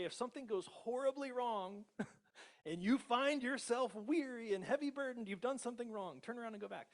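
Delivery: high, loud hammed-up scolding voice; mock the stern condition-setting God. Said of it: "He doesn't say".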